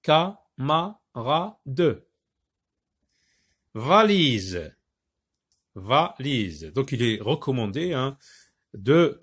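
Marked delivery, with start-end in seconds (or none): 0.0-2.0 Ka, ma, ra, de as four separate beats; syllable by syllable. Shown as a demonstration. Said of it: "écoutez bien". 5.8-6.7 Valise broken as VA, pause, LISE; lower, two-beat Valise; split into syllables. Shown as a demonstration. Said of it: "va se trouve".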